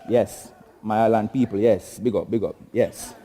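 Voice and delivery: deep, relaxed Jamaican island voice; Jamaican patois greeting. Acted. Comic greeting the Jamaicans in the crowd, in a low Jamaican lilt. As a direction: hail the island folk in patois.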